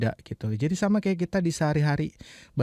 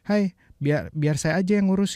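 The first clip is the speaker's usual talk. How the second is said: natural phrasing; 6 semitones higher than usual. Shown as a demonstration. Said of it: "Biasanya kalau orang Indonesia gitu".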